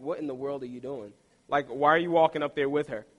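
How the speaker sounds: low, quick, hushed imitation of dad; muttered fatherly disapproval, acted out; disapproving father. Father questioning his son walking up on stage, disapproving. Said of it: "gave me that father look".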